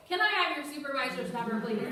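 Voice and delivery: playful imitation of an annoyed complainer; mock annoyed. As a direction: imitate someone irked, asking pointedly. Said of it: "she's annoyed".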